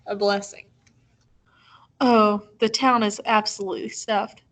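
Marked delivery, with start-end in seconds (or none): none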